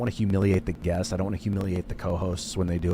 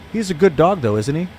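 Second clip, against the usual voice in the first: British tag question; 7 semitones higher, 6 dB louder than usual. Retold or imitated. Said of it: "it's a British thing".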